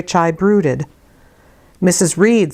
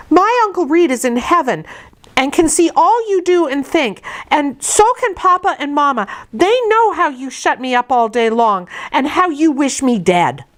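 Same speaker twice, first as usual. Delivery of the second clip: put-on defiant child; 9 semitones higher than usual. A narrator's reading. Child defies aunt in story.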